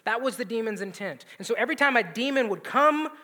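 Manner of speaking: usual speaking voice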